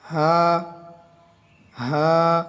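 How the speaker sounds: হা held one alif; short model হা; Arabic letter ha. Shown as a demonstration. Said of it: "এক আলিফ টান হবে".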